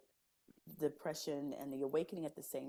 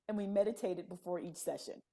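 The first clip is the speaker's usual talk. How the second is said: normal talking voice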